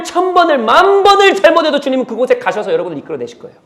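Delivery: loud, high, driving sermon voice; fiery preaching. Acted. Pastor urging the congregation, loud and high. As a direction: preach it with rising fire.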